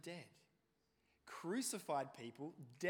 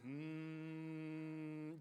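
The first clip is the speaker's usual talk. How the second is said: hum. Retold or imitated. Class humming to annoy teacher.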